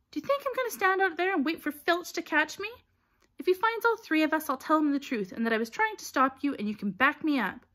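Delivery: high, forceful argument; heated, high-pitched protest; indignant. A narrator's reading. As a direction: argue back in a huff. Hermione arguing indignantly to come along.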